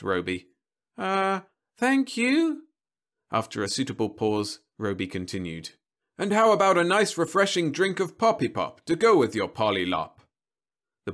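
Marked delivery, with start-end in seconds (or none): 1.0-2.7 put-on very slow, high child voice; high, halting little-boy voice; prompted small boy. A narrator's reading. Boy prompted to thank robot. 6.2-10.2 higher, louder TV-pitchman delivery; polished sales patter; smooth robot salesman. A narrator's reading.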